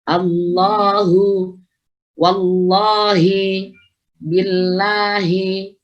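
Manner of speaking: very slow chant, final "hu" and "hi" fully sounded; sustained tajwid recitation; clear H. Shown as a demonstration. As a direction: stretch "Allahu wallahi billahi", keeping each H audible. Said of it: "harus jelas".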